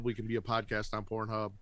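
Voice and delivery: ordinary delivery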